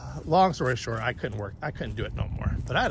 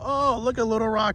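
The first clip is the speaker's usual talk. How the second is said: mock excited; 10 semitones higher, 6 dB louder than usual. Acted quote of younger self.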